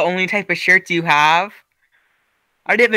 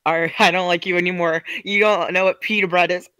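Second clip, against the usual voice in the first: normal talking voice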